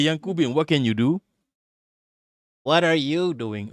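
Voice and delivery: ordinary talk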